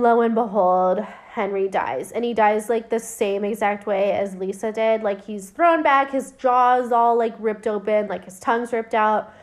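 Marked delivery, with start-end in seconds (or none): none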